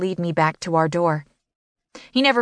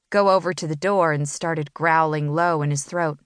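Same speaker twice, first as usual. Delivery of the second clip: ordinary delivery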